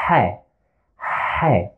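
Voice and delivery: H said as a breathy sound from the throat. Shown as a demonstration. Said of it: "The sound comes from here, the throat".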